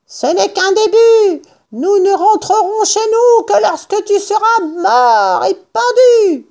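high-pitched furious farmer; yelled threat. A narrator's reading. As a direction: shout threats down a hole, voice pushed high. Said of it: "en hurlant dans le trou". (menaces hurlées, voix suraiguë)

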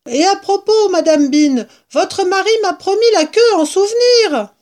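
high, loud holler; shouting. A narrator's reading. Woman reminds mistress of promised tail, shouting. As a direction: call it up to her upstairs. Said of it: "cria-t-elle".

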